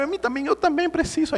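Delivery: high-pitched comic begging; mock needy cry; retold plea. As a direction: plead to be saved too.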